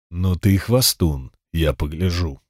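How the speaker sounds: low, scornful wrestler voice; low scornful jeer. Acted. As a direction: sneer it quietly and low. Wrestler answers cyclops.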